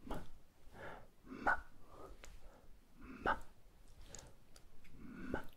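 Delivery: ma falling sharply; ma dropping from high to low; ma, falling fourth tone. Shown as a demonstration. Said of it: "Ça descend".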